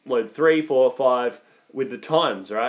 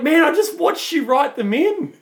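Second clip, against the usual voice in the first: exasperated; 13 semitones higher, 5 dB louder than usual. Retold or imitated. Retold argument with driver.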